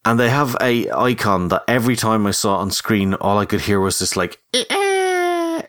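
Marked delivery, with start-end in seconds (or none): 4.5-5.6 high screeching eagle call; eagle cry. Retold or imitated. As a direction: imitate a distant echoing eagle. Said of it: "that sort of fade off into the background reverbed eagle sound".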